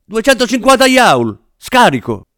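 loud, high medical callout; emergency command. A narrator's reading. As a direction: bark it at the team, urgently. Doctor calling out a defibrillation. (comando urlato in emergenza)

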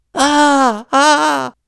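high, loud cry of pain; two sharp high-pitched yells of agony; pained scream. A narrator's reading. Man whose finger was just broken, screaming. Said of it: "cominciò a urlare".

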